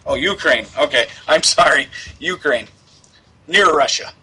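ordinary delivery

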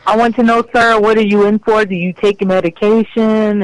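ordinary talk, nothing marked

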